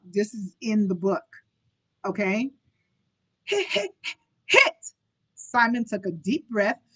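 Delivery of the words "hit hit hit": high, halting repeated syllable; stuttering child. A narrator's reading. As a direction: get stuck and keep forcing it out. Boy struggles to finish his sentence.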